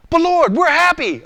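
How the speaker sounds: slow, high, loud plea; acted whining objection; protesting. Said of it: "listen to my responses".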